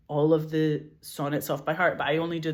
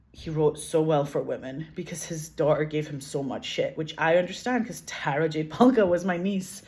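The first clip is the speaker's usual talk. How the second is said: ordinary delivery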